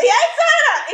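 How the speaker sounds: high, loud call across the street; retold yell. Brother calling out to his sister on the street, loud yell. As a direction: shout her name from across the street. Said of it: "el mae me grita".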